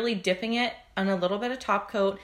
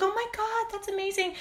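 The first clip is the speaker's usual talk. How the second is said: gushing. Retold or imitated. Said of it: "And she's like".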